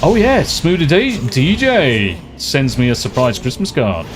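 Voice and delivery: ordinary talk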